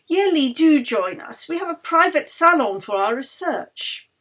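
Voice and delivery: eager Swedish baron inviting her; Baron's eager invitation. A narrator's reading. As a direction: draw her in with an eager, insistent host's voice. Eric's invitation.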